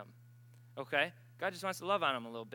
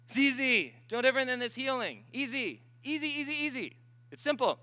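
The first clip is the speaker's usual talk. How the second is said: reassuring; 8 semitones higher, 5 dB louder than usual. Real, not acted. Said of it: "reassuring congregation about deliverance".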